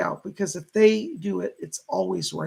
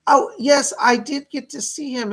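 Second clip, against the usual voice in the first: excited; 6 semitones higher, 6 dB louder than usual. Real, not acted. Greets donor's boyfriend news.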